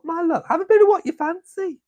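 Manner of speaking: high, teasing sing-song. Pretend. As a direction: lift it way up like a cheeky auntie. Repeats a saying in a put-on voice.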